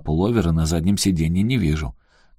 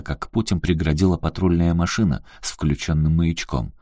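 plain reading voice

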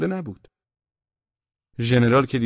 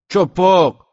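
high, loud reply; shouted answer; 8 semitones higher, 11 dB louder, much slower than usual. A narrator's reading. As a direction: call the word back to the sentry. Answering a shouting sentry from darkness. (جواب با فریاد)

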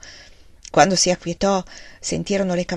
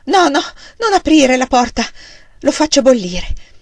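frightened; 4 semitones higher, 5 dB louder than usual. A narrator's reading. Frightened wife refuses opening door.

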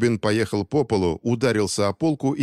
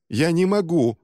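higher-pitched helpless wail, acted; pained moan; 7 semitones higher than usual. A narrator's reading. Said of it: "простонал Рубин". (жалобно простонать)

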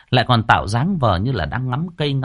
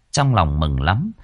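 ordinary delivery, nothing marked

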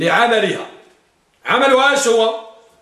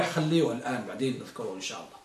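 fast, much softer and lower throwaway; quick muttered aside; 10 semitones lower, 14 dB quieter, much faster than usual. Real, not acted. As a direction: rush it under your breath. (همس سريع جانبي)

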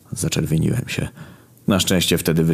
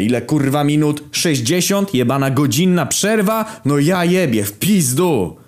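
furious rant; 10 semitones higher, slower than usual. Retold or imitated. Raging about the hour break.

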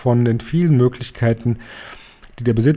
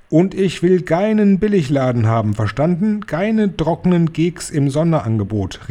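higher, stern demanding cry; higher, sternly demanding call; 7 semitones higher than usual. Acted. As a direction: lay down the law to her followers. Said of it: "rief die Hoch- und Großmeisterhexe".

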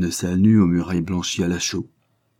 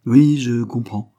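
slow, grave and louder; 5 semitones higher, 7 dB louder, slower than usual. Acted. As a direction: weigh each word gravely. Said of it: "affirma gravement".

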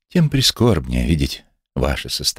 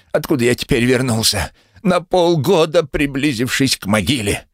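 angry retort; 10 semitones higher than usual. Acted. Invalid retorts to the herbalist.

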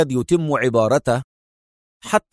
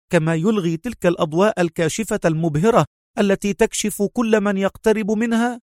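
higher, quicker anxious explaining; worried pitched-up urgency; more tense; 7 semitones higher than usual. A narrator's reading. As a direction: rush it out, rising anxiety. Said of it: "قالت في مزيد من التوتر".

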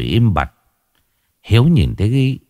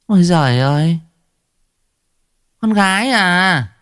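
put-on high, contemptuous old woman voice; a mother-in-law tossing away a granddaughter, higher and scornful; 13 semitones higher than usual. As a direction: sneer at the worthless newborn girl. Mother-in-law scorns baby girl.

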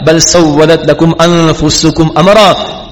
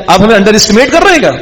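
higher, fast mock-offended question; brothers' offended tone; 5 semitones higher, faster than usual. Brothers asking their father if he underestimates them, mock-offended. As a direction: rattle off the mock hurt as a wounded son. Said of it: "یہ بھی tone ہے ہمارے یہاں کی".

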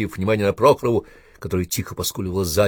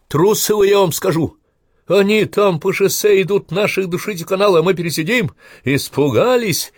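much higher, louder furious outburst; wheezing accusation; 12 semitones higher, 7 dB louder than usual. A narrator's reading. Wounded Komsomol organizer accusing officers of cowardice, much higher and louder. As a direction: hurl it at them through a bandage. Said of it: "хрипел он через промокшую".

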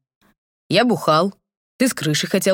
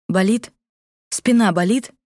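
quick sharp question; 4 semitones higher than usual. A narrator's reading. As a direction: ask it sharply. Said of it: "Резко спрашиваю я".

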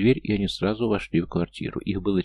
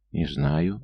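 plain reading voice

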